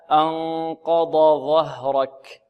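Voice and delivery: "أنقض ظهرك" slow with dad and zha distinct; correct slow recitation. Shown as a demonstration. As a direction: model it slowly and clearly. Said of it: "هكذا". (ترتيل صحيح بطيء)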